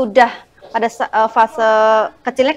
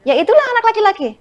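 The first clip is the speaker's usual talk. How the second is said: exasperated; 11 semitones higher, 3 dB louder, much faster than usual. Acted. Exasperated parent sums it up.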